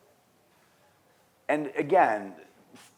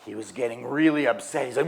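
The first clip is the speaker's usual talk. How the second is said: ordinary talk, nothing marked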